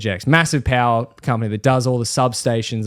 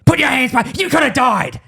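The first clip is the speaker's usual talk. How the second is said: high, raised warning yell; angry shout; 14 semitones higher, 3 dB louder than usual. Retold or imitated. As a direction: scold him for reaching in. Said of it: "And he's like".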